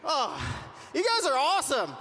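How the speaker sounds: usual speaking voice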